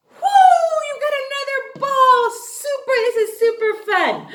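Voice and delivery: very high, loud woo; excited cheer. Real, not acted. Teacher cheering kids who got the ball, high and loud. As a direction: whoop with delight. Teacher celebrating a correct answer.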